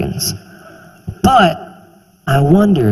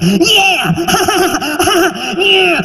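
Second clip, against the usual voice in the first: gleeful audience guy. Pretend. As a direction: whoop and laugh at the slurs. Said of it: "see some guy just like".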